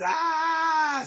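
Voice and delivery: long high "ah" of mock outrage; hammed-up drawn-out exclamation; indignant cry. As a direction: draw out a high, scandalized cry. Cry ending the acted outrage.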